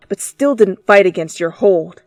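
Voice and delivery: usual speaking voice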